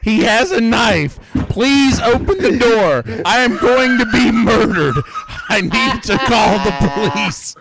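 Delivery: high, loud, frantic screaming at the door; panicked plea for help. Acted. Victim screaming at the door to be let in, panicked. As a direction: beg to be let in, terrified and shrieking.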